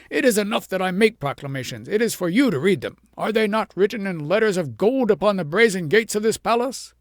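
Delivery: king scolds the poor shepherd; lordly king. A narrator's reading. King rebukes shepherd, haughty and loud.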